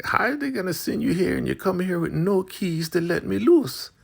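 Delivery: very high exasperated complaint; imitating an annoyed woman, very high. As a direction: whine it up high.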